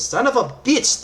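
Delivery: sharp loud high curse; loud angry curse; cursing shout. Acted. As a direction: blurt it out in frustration. GM voices Sable cursing.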